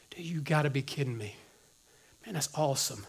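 hushed mock excitement; soft whispered delight. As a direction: whisper it like a secret thrill. Imagined excited response, whispered.

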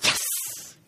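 whispered, hissing yes; hushed exclamation. Retold or imitated. Speaker privately thanking God after a woman's thanks, whispered. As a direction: whisper a grateful yes under your breath. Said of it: "I just went".

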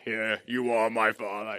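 deep, quiet villain impression; Darth Vader. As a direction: drop into a low movie-villain voice. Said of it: "You know, like Darth Vader".

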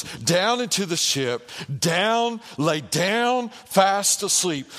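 steady preaching drive. Acted. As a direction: press the point home, line after line. Preaching Jonah going down.

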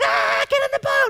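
shrill-high panicked shout, hammed-up; mock panicked disciples; frantic call. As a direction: yell at him from the boat. Disciples calling out to Jesus.

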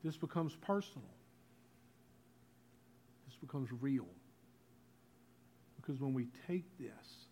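hushed, measured preacher; quiet, reverent delivery with long pauses; solemn communion address. Real, not acted. Solemn invitation to take communion.

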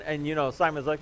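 normal talking voice

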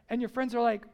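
normal talking voice

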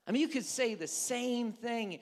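normal talking voice

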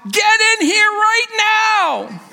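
high, loud, slow mock yell of an angry mom; imitated yell. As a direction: bark it like a mother calling her son in. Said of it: "my wife yelling out".

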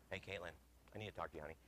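soft, tender call; softly at her door; gentle. Retold or imitated. Father at daughter's door, soft and tender.

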